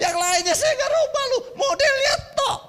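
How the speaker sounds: very high, loud mock shouting; hammed-up outburst, dragging out the last words; angry retort. Husband re-enacts arguing back at his wife, comically furious. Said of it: "Saya juga marah".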